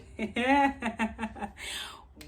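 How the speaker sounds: loud, high cackle of disbelief; scornful burst of laughter; incredulous laugh. Retold or imitated. Scoffing laugh at the insult.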